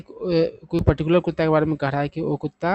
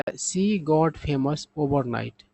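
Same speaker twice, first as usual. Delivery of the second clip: very slow, word by word English; slow example sentence; much slower than usual. Shown as a demonstration. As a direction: read it out slowly for learners. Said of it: "sentence में इसका use देखिए".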